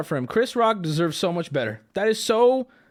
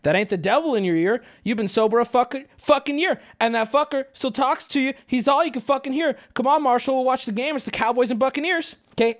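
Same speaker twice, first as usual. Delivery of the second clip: heated, raised ranting voice; angry rant; 7 semitones higher than usual. Real, not acted. Ranting at Will Smith on stream.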